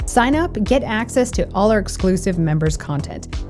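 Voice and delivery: normal talking voice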